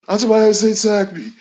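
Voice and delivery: high, words run together; crammed into one syllable. Retold or imitated. Host shows how he said the lyric, words crammed together. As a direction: squash all the words into one burst. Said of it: "you said it in one, in one".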